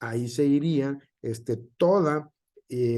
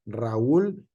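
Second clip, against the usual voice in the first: usual speaking voice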